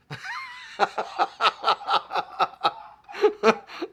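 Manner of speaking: loud, high-pitched burst of laughter; long helpless cackle at yourself; real hearty laugh. Real, not acted. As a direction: crack up loudly and keep going. Laughs at his own crying story.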